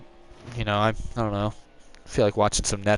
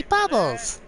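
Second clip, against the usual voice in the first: Bubbles sung out much higher and bright; happy Bubbles; 14 semitones higher than usual. Shown as a demonstration. Streamer showing that Bubbles wants a happy voice. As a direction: squeal it out happily. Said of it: "You just wanna say".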